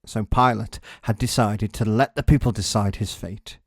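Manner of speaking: usual speaking voice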